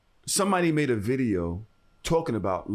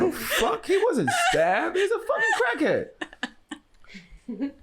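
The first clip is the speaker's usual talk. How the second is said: very high, drawn-out incredulity; exasperated outburst; slower than usual. Retold or imitated. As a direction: squeal it out slowly in comic outrage. Acted exasperated outburst to her.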